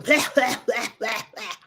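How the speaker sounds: mock hacking cough noises; imitated coughing fit. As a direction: fake a string of coughs. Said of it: "when I was coughing".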